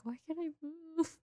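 high, soft mock plaintive whine; retold crying. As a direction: imitate a tearful, helpless plea under your breath. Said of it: "I just start crying".

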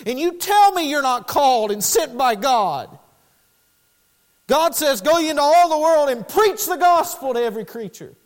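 loud, high pulpit shout; sermon climax at full voice; thundering preaching. Real, not acted. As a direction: hurl it at the congregation as a challenge.

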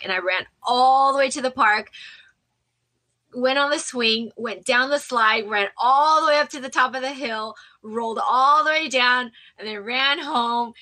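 high and loud, thrilled retelling; breathless with joy; excited. Retold or imitated. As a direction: relive the mad dash to the park. Retelling a joyful run.

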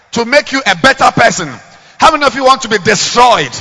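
loud, high fiery preaching; preaching at full force. Acted. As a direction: thunder it from the pulpit.

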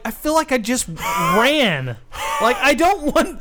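high, exasperated comic whine; hammed-up protest from a reluctant dancer; worn-out complaint. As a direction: moan in mock distress. Acting winded complaint while dancing.

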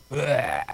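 low, breathy noise of disgust; disgusted "bleh". Acted. Reacting to people who act superior, disgusted "bleh". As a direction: let out a mock retch of distaste.

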